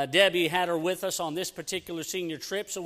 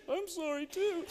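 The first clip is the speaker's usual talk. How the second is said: much higher and soft, a mock tiny weepy voice; small tearful apology; 10 semitones higher, 7 dB quieter than usual. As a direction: imitate the wife's meek sorry. Husband echoing meek apology.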